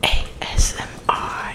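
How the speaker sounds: soft, voiceless whisper; hushed whispered letters; ASMR whisper. Pretend. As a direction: whisper it close into the mic.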